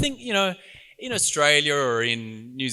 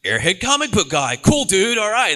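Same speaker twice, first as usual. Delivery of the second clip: loud, high-pitched cool-dude airhead voice; big, loud airhead dude character; airhead comic book guy; 8 semitones higher, 7 dB louder than usual. Acted. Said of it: "You slip into that so easily".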